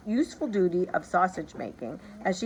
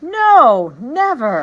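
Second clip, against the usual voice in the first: loud, high chorus of ministers; shouted; 6 semitones higher, 14 dB louder, much slower than usual. A narrator's reading. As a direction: shout it in eager agreement. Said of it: "and shouted".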